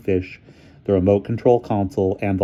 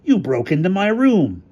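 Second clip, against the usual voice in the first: character voice; 11 semitones higher, 4 dB louder than usual. A narrator's reading. Hamilton accusing.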